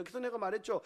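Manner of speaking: ordinary delivery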